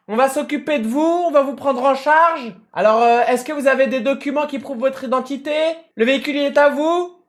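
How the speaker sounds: lightly voiced high paramedic, overly careful; a hint of the patronising first aider. Firefighter questioning the dazed driver, lightly mocked careful voice. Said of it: "quand il te parle comme ça".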